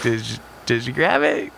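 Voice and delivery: normal talking voice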